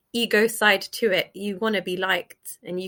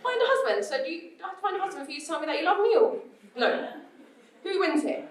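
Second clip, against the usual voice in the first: high, outraged disbelief; incredulous reaction; 8 semitones higher than usual. Retold or imitated. Daughter reacting to her dad's answer, high incredulous. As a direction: squeak out the betrayal, half laughing. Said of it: "reacting to dad's reply".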